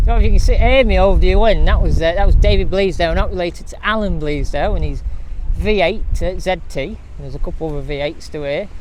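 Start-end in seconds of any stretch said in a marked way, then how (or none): 0.1-7.0 raised voice against the wind noise; shouting over wind. Real, not acted. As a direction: call it out loud over the wind. Outdoors, noisy, talking over wind.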